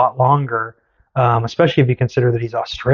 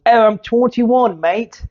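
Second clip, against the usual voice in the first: slow, higher-pitched mock Australian; amateur put-on Australian, a bit Cockney; Australian accent; 9 semitones higher, slower than usual. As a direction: stretch it out in a hammy Aussie accent. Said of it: "it's my Australian".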